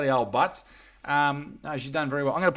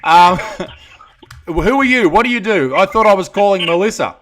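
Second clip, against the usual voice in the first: loud, higher hammed-up confusion; mock surprise; 6 semitones higher, 9 dB louder than usual. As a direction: play it up as the wrong caller. Host mock-confused at wrong caller.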